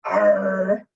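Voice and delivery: loud, drawn-out pirate arr; pirate growl. Pretend. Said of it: "We won't do the pirate thing".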